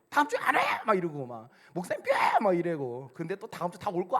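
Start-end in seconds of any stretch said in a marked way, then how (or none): 0.1-0.6 high, loud squawk of annoyance; hammed-up huffy outburst; mock tantrum. Annoyed churchgoer threatening to quit next week, mock tantrum. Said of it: "풀풀풀거리고 짜증내고". 1.7-2.1 very high, loud squeal; hammed-up childish sulk at the pastor; mock tantrum. Sulky churchgoer lashing out at the pastor, mock tantrum. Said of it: "풀풀풀거리고 짜증내고".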